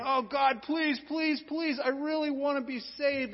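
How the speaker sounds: a hammed-up beg to God; pleading. A sinner begging God in a mock pleading voice. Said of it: "It's not like people are pleading".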